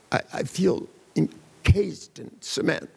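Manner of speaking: imitating a depressed client, dragging each word; halting and slow, as if weighed down; slow depressive. Depressed client describing her state to the therapist, slow and laboured. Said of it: "She spoke so slowly".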